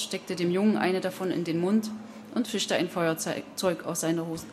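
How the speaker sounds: ordinary delivery, nothing marked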